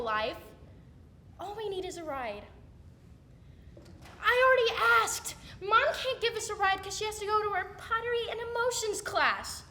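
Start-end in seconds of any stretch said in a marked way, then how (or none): 4.2-7.1 louder, higher whiny objection; exasperated protest. Acted. Teen begging sibling for a ride, exasperated. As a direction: push back loudly, fed up.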